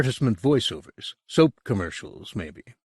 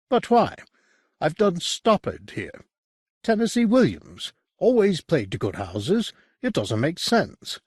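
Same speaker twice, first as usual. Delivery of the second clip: high, pressing disbelief, pushing back hard; a stung director who can't understand why; raised, incredulous voice of the wronged director; dismayed Calloway protesting; 9 semitones higher than usual. Acted. Calloway protests the theatre closure.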